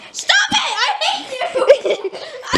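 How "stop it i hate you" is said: very high yell, half laughing. Real, not acted. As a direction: shriek it while getting soaked. Water prank, yelling at friend.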